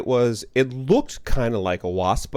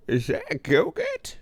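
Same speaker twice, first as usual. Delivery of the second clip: much higher, slow silly voice; 9 semitones higher, 3 dB quieter, slower than usual. Shown as a demonstration. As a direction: squeak Go-Gurt slowly, playfully.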